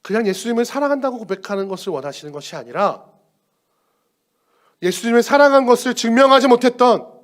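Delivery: ordinary delivery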